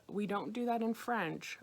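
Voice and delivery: ordinary talk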